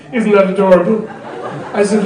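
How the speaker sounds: ordinary talk, nothing marked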